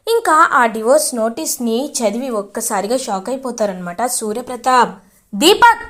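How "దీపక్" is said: sharp raised call of a name; loud shout. Acted. Father-in-law shouting for Deepak over divorce notice, loud. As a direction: bark the name out in alarm. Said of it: "అని గట్టిగా అరుస్తారు".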